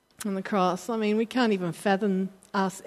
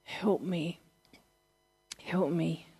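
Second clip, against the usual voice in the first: softer, pleading cry for help; desperate plea; 3 semitones lower, 7 dB quieter, slower than usual. Retold or imitated. Jesus in Gethsemane crying out to God, soft pleading. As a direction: beg quietly, a touch broken. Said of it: "he's crying out to God".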